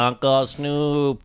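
ordinary talk, nothing marked

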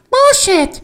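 very high cartoon falsetto; squeaky character swearing; Mister Poopy Butthole. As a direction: pitch it way up, high and silly. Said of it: "how Mister, uh, Poopy Butthole would say".

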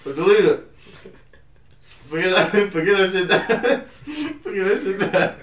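loud, high, laughing plea; laughing embarrassed. Real, not acted. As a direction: laugh while begging to delete it. Embarrassed, laughing at own line.

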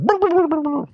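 high, blubbering imitated noise; Boss Nass jowl shake. Imitating someone shaking it out, wobbly blubbering noise. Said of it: "the boss nass, like".

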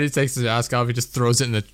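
usual speaking voice

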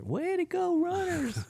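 high, bright cheer; mock cheer for runners.

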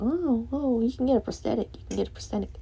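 high, sing-song fake sympathy voice; mocking sympathizers. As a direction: parody a condescending well-wisher. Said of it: "they can go".

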